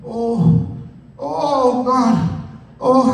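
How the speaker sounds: hammed-up suffering; toothache groaning. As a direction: groan like a coworker with a bad tooth. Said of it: "my coworker's sitting there, and he goes".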